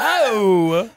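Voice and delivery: high, loud hammed-up yelp of pain; mock pained shriek. Co-host acting out being bitten in a fake fight. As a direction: yelp like you just got bitten, laughing.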